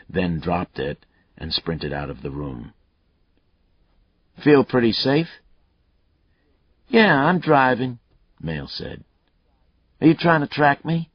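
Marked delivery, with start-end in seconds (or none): 4.4-8.0 a lightly voiced cocky killer, higher and louder; taunting killer. Killer Mayle taunting Lucas over the phone, cocky and loud. 10.0-11.1 a lightly voiced cocky killer, higher and louder; taunting killer. Killer Mayle taunting Lucas over the phone, cocky and loud.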